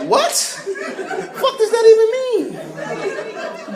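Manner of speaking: high, slow, laughing disbelief; baffled disbelief. Real, not acted. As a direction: stretch it out in baffled shock. Incredulous reaction, high and slow.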